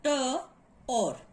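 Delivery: "t, or" as two isolated sounds; sounded out. Shown as a demonstration. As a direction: pull "tor" apart into t, then or. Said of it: "phonetic transcription of this syllable tor".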